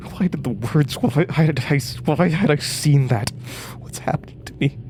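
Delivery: rising panic. Acted. Reeling from the vision, she asks herself in rising panic. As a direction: spiral upward into panic, then whisper.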